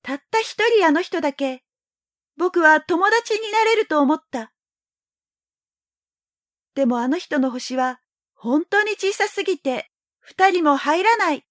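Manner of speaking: put-on high, wistful little boy; young boy voice. A narrator's reading. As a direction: sigh it like a lonely child. Prince speaks his thoughts.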